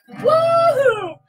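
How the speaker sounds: very high, loud woo-hoo; unicorn cheer. Acted. Voicing the unicorn's joyful cheer. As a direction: squeal it like a giddy unicorn. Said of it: "They said".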